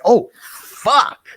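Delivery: high, loud shocked exclamation; mock crowd amazed by the strike; crowd's shocked gasp. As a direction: blurt it like a stunned crowd. Crowd reaction imitated.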